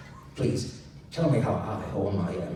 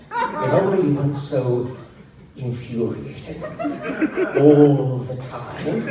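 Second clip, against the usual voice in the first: louder, slow words broken by laughter; laughing through line; 5 semitones higher, 9 dB louder, slower than usual. Real, not acted. Emperor scolding Ethan while the reader laughs. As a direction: fight the laugh and lose.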